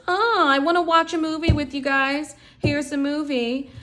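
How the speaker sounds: high, sugary mock mother; mother coming home, faking cheerful movie night; fake cheerful mom. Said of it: "she comes in and says".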